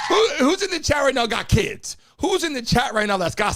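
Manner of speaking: calling out. Real, not acted. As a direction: shout the question out to everyone. Host calling out to chat.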